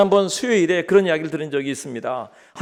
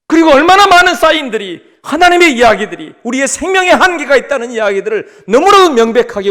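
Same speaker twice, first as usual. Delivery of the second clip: high, loud, emphatic sermon voice; full-force preaching; 10 semitones higher, 10 dB louder than usual. Acted. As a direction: drive it home to the congregation, loudly. (힘주어 외치는 설교)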